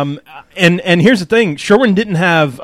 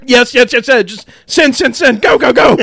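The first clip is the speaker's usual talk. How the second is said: frantic excitement; 7 semitones higher than usual. Real, not acted. Fan thumbing victory texts, high and giddy. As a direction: cheer like the race just ended.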